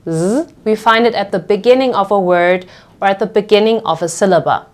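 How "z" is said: Z as a short voiced buzz; vibrant S. Shown as a demonstration. Teacher recalls the earlier rule, Z buzzed and vibrant. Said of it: "the vibrant S".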